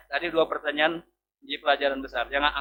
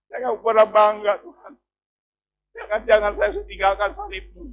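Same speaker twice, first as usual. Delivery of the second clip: pleading prayer; 5 semitones higher, 7 dB louder than usual. Retold or imitated. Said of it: "Saya tanya".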